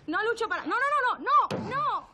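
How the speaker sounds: high-pitched playful protest; playful squeal. Real, not acted. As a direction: squeal it out, giggly and teasing.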